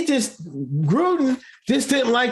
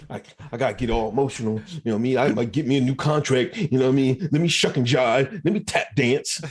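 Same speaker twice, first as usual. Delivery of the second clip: mocking Randy rehearsing; 8 semitones lower, faster than usual. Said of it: "Randy was practicing last".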